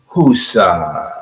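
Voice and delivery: low, soft whoosah, settling down; calming exhale. Shown as a demonstration. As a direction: sink into a low, quiet release. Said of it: "Everybody do it with me".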